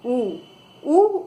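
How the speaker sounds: उ said twice, slowly and clearly, lips rounded; a teacher modelling उ for pupils to copy; demonstrating the sound उ. Shown as a demonstration. Teacher shows children how to say उ, clear slow demo. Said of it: "अब उ का उच्चारण कर कर देखिए".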